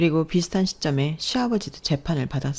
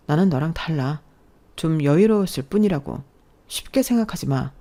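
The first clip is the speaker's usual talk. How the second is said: ordinary delivery, nothing marked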